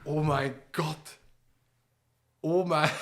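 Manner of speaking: slow, quiet stunned gasp. Real, not acted. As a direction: breathe it out in disbelief. Reagiert auf Einspieler.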